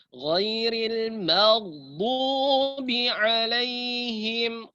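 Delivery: slow, chanted ghairil maghdubi alaihim; correct recitation. Shown as a demonstration. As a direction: recite it drawn out. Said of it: "Bagaimana, Ustaz?". (bacaan benar dilagukan pelan)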